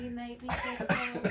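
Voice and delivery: loud mock complaint, slow; playfully protest, loud and slow. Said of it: "I'm shivering".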